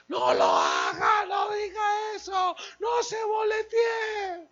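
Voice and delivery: high, slow, shouted plea of the wife; loud slow mock wife scolding; wife's loud warning. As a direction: imitate the wife begging him to stop.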